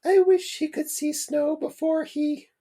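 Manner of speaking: a high tender mum; mother's voice. Acted. Mum at son's bedside, high falsetto mother voice. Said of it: "she found it almost impossible to say".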